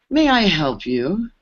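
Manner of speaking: slow, drawn-out, looking-down-on-you question; condescending engineer. Retold or imitated. Engineer sizing up the newcomer he assumes is lost, slow and condescending. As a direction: ask it slowly, as if she's clearly lost. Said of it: "turns his head sideways and goes".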